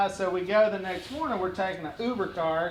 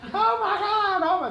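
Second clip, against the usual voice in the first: high, louder fan squeal; mock excited scream; 12 semitones higher, 5 dB louder than usual. Lady spots him getting in the car, mock screaming. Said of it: "She started screaming the minute".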